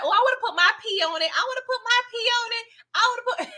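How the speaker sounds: mock the clueless girls in a squeaky, giddy voice; silly tipsy girls. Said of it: "They was like".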